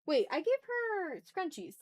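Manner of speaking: ordinary talk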